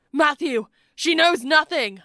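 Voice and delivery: high, urgent shout; crying out. A narrator's reading. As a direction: call out in desperate protest. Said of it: "Toby cried".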